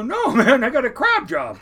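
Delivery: high and loud with laughter; telling his hunting buddies he got a crab job, excited and laughing. Retold or imitated. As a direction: burst out with the good news, laughing.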